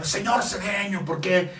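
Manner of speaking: a touch of an irritated creditor's voice; angry bill collector. Retold or imitated. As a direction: imitate the annoyed bank manager calling. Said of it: "me dijo muy enojado".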